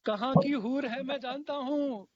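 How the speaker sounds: slow, drawn-out ghazal recital; stately nazm recitation. Acted. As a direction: lean into each line, then pause. Recites couplet for Rahman.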